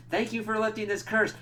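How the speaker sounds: a sing-song mock thanks; mock grateful Danny. Danny thanking Johnny for lifting the curse, in a high mock voice.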